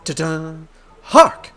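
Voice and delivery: loud, hammed-up detective proclamation; theatrical inspector. Inspector announces a sword thief, hammed-up and triumphant. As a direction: burst in high, then declaim grandly.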